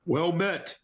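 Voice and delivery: mock yell of greeting; half-hearted call out. Player hails approaching soldiers in character, half-hearted call out. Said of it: "yell out".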